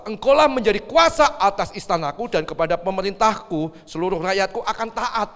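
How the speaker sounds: lightly acted royal proclamation; Firaun. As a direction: declare it loudly, as a ruler granting power. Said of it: "Fir'aun ngomong kepada Yusuf". (titah raja yang lantang)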